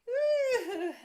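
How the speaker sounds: very high loud woo with a laugh; excited whoop. Pretend. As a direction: let out a big laughing woo. Stretching game with kids.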